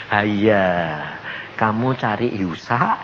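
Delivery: low, slow, tender divine voice; slow, low, soothing reproach; gentle voice. Retold or imitated. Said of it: "Lembut. Ngomongnya gini".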